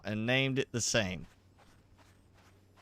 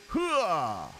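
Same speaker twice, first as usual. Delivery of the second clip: gung-ho soldier cry; 5 semitones higher than usual. Pretend. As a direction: bark it like a pumped-up marine. Battle cry as the bomb goes off.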